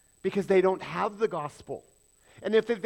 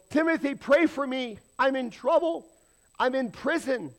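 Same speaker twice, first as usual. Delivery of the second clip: higher, louder hammed-up plea for help; mock pleading; 8 semitones higher, 4 dB louder than usual. Paul imagined begging Timothy from prison, mock pleading. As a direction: play a desperate prisoner begging a friend, tongue in cheek.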